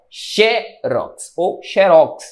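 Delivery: "Xerox" as slow XÉ-rox, then "xerox" as xe-RÓX; slowly, first "Xerox" front-stressed, then "xerox" end-stressed; both stress patterns. Shown as a demonstration. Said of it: "Por isso você vê as duas escritas".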